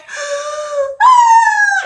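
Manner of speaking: loud, very high crying sound; hammed-up child's bawl; dramatic mock wail. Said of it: "She's so dramatic".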